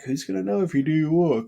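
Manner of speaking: tempting thought. Retold or imitated. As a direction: voice the nagging thought that nobody will check. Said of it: "I had all of them of, like".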